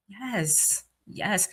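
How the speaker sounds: soft, drawn-out yes; quietly relishing it; savoring yes. Retold or imitated. Listener sitting back to the music, savoring softly.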